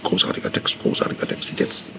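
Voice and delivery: whispered mock praise. Mimicking clients who praise the great text, whispered. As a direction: hush it like a conspiratorial client.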